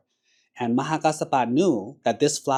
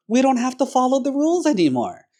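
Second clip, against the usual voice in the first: "rules" crowed loud and high; loud, much higher, exultant; gleeful shout; 13 semitones higher, 7 dB louder than usual. Retold or imitated. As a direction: shout it in triumph. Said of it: "who said to his friends".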